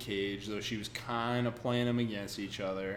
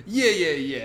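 louder, higher mock impatience; impatient brush-off; 6 semitones higher, 8 dB louder than usual. Diamondback cutting off her long list, mock impatient. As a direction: hurry her along with quick yeahs.

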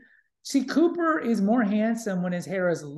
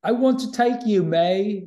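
higher, louder mock Cockney; Cockney accent; 4 semitones higher, 5 dB louder than usual. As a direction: belt it out in a put-on Cockney accent. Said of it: "No, that's my Cockney".

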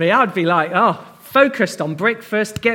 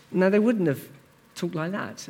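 ordinary delivery

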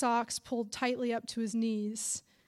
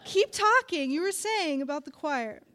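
laughing tease; 5 semitones higher, 5 dB louder than usual. Acted. Teasing grandma, laughing.

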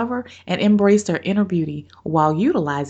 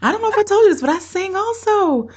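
much higher and louder, slowing at the end; excited; 13 semitones higher, 4 dB louder than usual. Real, not acted. Host excitedly telling guest she sings too. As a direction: gush it out, thrilled to share.

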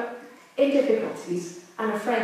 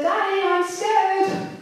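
put-on high, slow, sing-song call of a frightened child; scared little boy; 11 semitones higher, 4 dB louder, slower than usual. Boy in bed calls to his dad during thunderstorm, scared and high.

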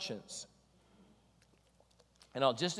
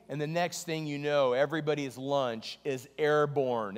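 ordinary delivery

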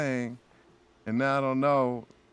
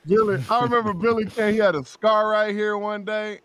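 ordinary talk

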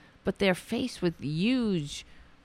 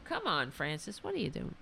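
softer, gentle coaxing of the dog; hushed pet talk; 10 dB quieter, faster than usual. Real, not acted. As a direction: coax the dog down quietly. Talking to his dog.

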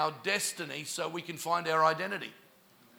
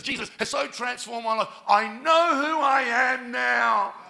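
retold triumphant testimony; 5 semitones higher, 7 dB louder than usual. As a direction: proclaim her discovery, slowing into a shout. Girl's triumphant testimony, acted for congregation.

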